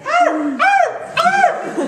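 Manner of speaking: very high, loud chimp screaming. Shown as a demonstration. Lecturer showing the shouting climax of a pant hoot. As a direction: shriek like an angry chimp. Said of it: "finally they start shouting".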